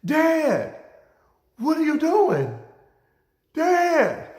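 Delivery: put-on high, loud kid's cry to his dad; retelling a boyhood protest to his father, high and loud; young son calling out. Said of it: "And I'm like".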